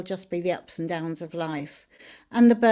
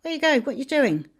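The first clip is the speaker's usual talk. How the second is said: much higher, nagging little dog calling after someone; yappy dog voice; 6 semitones higher than usual. Retold or imitated. Teacher voicing the little dog chasing the Fool, high nagging call. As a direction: pester him like a fussy little dog. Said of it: "little dog there going".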